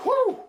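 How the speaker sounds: sudden high excited whoop. Retold or imitated. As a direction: let out a sharp high whoop. Hyping up the punch.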